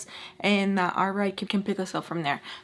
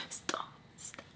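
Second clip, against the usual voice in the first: an angry, hushed whisper; urgent whisper; 18 dB quieter than usual. Retold or imitated. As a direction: hiss it so no one else hears. Pissed, whispering to friend.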